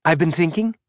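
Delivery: "thinking" lifts upward at its end like a question; rising at the end. A narrator's reading. Nervous Elvie opening to Holden, "thinking" rising upward. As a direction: let "thinking" curl up high though it is no question. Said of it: "her voice rising at the end of the word".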